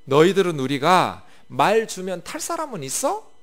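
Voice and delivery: sneering question from an arrogant general; mocking taunt. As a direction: scoff at the enemy's weakness. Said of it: "이렇게 조롱하고 있는 거예요".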